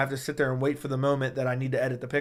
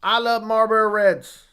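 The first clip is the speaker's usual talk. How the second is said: higher, slow country drawl; country accent; 5 semitones higher, 4 dB louder, slower than usual. Shown as a demonstration. Streamer showing a viewer his best country accent. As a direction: savor it in a put-on country accent. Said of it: "That's the best I got for country accent".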